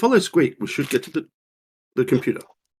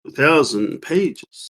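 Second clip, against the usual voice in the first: ordinary delivery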